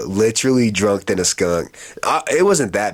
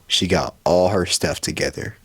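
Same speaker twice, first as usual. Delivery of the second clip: normal talking voice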